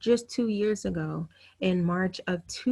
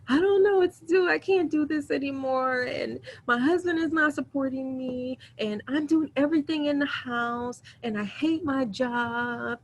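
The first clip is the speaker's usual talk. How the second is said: crying; 6 semitones higher than usual. Retold or imitated. Pouring it all out to her doctor, crying. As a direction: choke the words out through tears. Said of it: "I am bawling".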